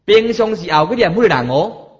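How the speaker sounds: ordinary talk, nothing marked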